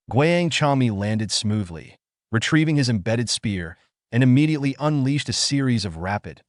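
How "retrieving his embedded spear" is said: ordinary delivery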